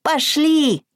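high, bright piglet call; cheerful piglet. A narrator's reading. Piglet calls hare along.

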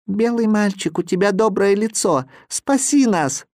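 put-on high, begging child voice; boy pleading. A narrator's reading. Boy on snowy peak begs Viktor for rescue, pleading. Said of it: "Негритёнок меня заметил и сказал".

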